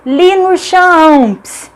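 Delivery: high, stretched mnemonic word; exaggerated elongated pronunciation; drawn-out reading. Shown as a demonstration. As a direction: read it slowly, dragging out each syllable. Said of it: "Vamos ler".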